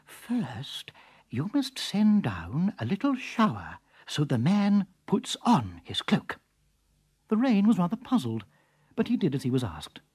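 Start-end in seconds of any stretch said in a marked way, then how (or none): 0.1-6.4 slow whispered instruction. Acted. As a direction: whisper it slowly to the rain. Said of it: "the weatherman whispered".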